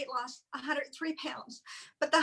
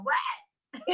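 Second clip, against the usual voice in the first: mock innocent what; 5 semitones higher, 10 dB louder than usual. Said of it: "giving it to my husband and go".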